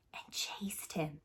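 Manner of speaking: unvoiced storyteller whisper; spooky hush. A narrator's reading. Narrator hushing the children at the nightmare's scariest moment. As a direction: whisper it like a scary bedtime tale.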